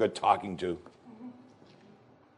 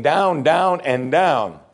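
drawn-out down; 3 semitones higher, much slower than usual. Acted. Life cascading ever further down.

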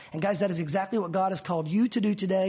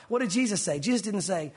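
normal talking voice

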